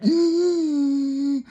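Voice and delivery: drawn-out squealing laughter; long high laugh. Real, not acted. Storyteller can't stop laughing at the spanking story, long high laugh.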